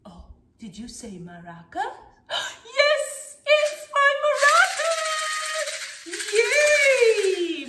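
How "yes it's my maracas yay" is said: very high, drawn-out squeal of joy; excited reveal. Real, not acted. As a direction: celebrate the guessed instrument loudly. Revealing the instrument to children.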